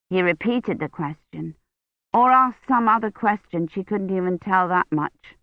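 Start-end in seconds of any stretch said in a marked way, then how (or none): none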